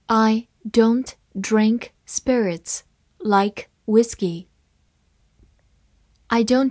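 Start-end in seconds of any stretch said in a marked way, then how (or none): 0.1-4.5 slow, careful reading; deliberate classroom read-aloud, slowed down. Shown as a demonstration. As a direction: read it slowly word by word for learners. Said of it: "好，慢慢来读".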